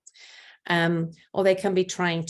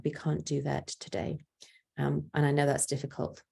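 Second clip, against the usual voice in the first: gentle soft voice; 2 semitones lower, 6 dB quieter than usual. Retold or imitated. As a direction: drop much softer and gentle, almost a whisper at the end. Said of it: "by holding you and hugging you".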